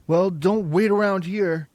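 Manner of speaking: loud, high savage snarl; savage cry. A narrator's reading. Bully snarling at Margot, loud and savage. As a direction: throw it at her savagely. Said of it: "cried the boy savagely".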